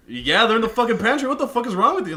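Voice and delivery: high, loud mock-angry snap; hammed-up annoyance at a guest; exasperated yell. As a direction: friend asking for water he knows where to find, exasperated outburst. Retelling angry reply to guest.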